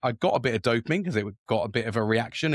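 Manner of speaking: ordinary delivery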